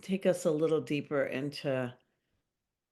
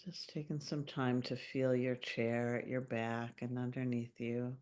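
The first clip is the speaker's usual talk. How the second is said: calm meditation voice with long pauses; unhurried, gently guiding listeners into their bodies; guided meditation; 4 semitones lower, 4 dB quieter than usual. Acted. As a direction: lead a grounding visualization, phrase by phrase, leaving long silences. Leads a grounding meditation.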